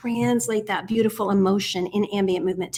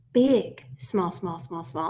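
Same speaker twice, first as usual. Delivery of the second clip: full-voiced "big", then "small" low and soft; 4 semitones lower, 5 dB quieter, slower than usual. Shown as a demonstration. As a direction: drop right down for each "small". Demonstrates big then small emphasis.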